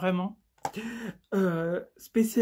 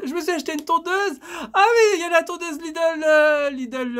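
higher and louder, eager burst; excited; 8 semitones higher, 7 dB louder than usual. Real, not acted. Vlogger remembering a new clipper, excited burst. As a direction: blurt it out excitedly.

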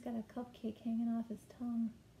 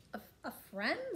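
higher, stammered query from a surprised queen; storybook queen; 6 semitones higher than usual. A narrator's reading. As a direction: echo it higher, as a puzzled queen. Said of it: "said the queen".

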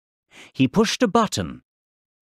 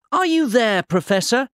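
shouting to someone; 10 semitones higher than usual. A narrator's reading. Boy outside calls to the professor in the shed, shouting. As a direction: call out a question to someone out of view. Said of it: "he shouted".

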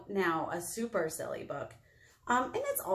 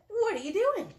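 high rabbit voice; 13 semitones higher, 4 dB louder than usual. Acted. Rabbit character question.

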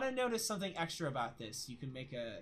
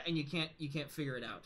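normal talking voice

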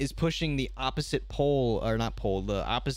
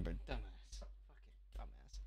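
much softer, a breathy under-the-breath insult; muttered insult; 7 semitones lower, 19 dB quieter, slower than usual. Real, not acted. Streamer insulting someone in a clip, muttered. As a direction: mutter it to yourself.

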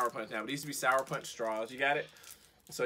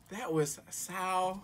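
slow and strained; 5 semitones higher, 3 dB quieter, much slower than usual. Real, not acted. After sour candy.

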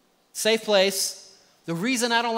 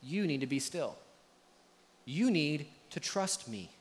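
a hushed, lightly voiced God, much quieter; gentle voice of God; 6 semitones lower, 11 dB quieter than usual. Pastor voices God telling the worried man to trust. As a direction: speak softly as God calming his child. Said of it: "And yet God says".

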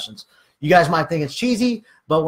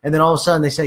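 ordinary talk, nothing marked

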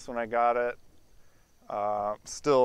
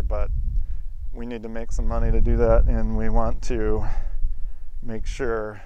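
shouting over machinery; 10 dB louder than usual. Real, not acted. Loud outdoors near running equipment.

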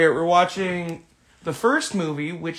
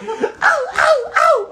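high yelped ow ow ow, much louder; Adam Sandler impression; 9 dB louder, much slower than usual. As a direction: yelp it like a goofy comedian. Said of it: "my Adam Sandler impression".

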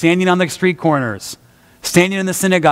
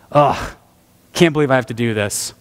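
muttered grumble; 6 semitones lower than usual. Retold or imitated. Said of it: "my inward stuff was like".

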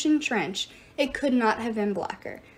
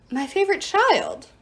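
surprised; 8 semitones higher, 3 dB louder than usual. Acted. Goddess taken aback by question.

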